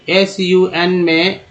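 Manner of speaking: slow letter-by-letter spelling; name each letter slowly; spelled out letter by letter. Shown as a demonstration. Teacher spelling sun for students, slowly letter by letter. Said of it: "यू का उच्चारण".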